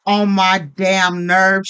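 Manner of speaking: drawn out, each word dragged slowly; slow irritated emphasis. Real, not acted. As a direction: spell out her annoyance slowly. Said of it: "irritated emphasis".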